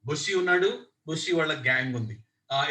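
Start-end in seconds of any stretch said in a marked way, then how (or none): none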